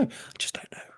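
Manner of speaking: whispered, barely audible; breathy repeat, voiceless. Shown as a demonstration. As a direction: drop it to a secret whisper. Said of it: "repeated as a whisper".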